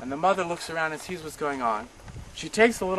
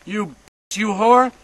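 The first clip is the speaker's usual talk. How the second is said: loud, higher brutal yelling; furious guard; 5 semitones higher, 8 dB louder than usual. Retold or imitated. Guard yelling while slapping the woman, loud and furious. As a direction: spit it at her in rage. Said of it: "The man slapped the woman again".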